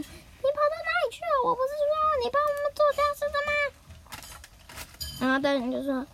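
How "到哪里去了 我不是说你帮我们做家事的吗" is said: much higher, fast nagging mom voice; scolding mother. Acted. Mother scolding Nobita for not doing chores, high and fast. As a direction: rattle off the scolding like a cross mom.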